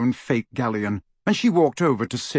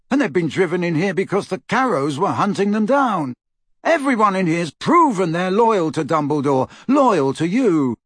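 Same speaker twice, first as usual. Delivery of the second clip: higher-pitched, insistent appeal; earnest Neville; 6 semitones higher, 3 dB louder than usual. Acted. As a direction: argue for your friends' loyalty. Voices Neville arguing loyalty.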